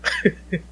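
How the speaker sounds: high, rising effort grunt; jumping effort sound. Shown as a demonstration. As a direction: gather energy, push the sound up. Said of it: "An upward inflecting effort noise".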